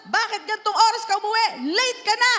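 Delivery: put-on high, loud scolding of a late child; mock strict parent scolding. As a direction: scold the kid who came home late. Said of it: "sasabihin niya".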